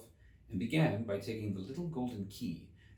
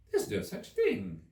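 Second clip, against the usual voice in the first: angry; 11 semitones higher than usual. A narrator's reading. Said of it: "Alice was beginning very angrily".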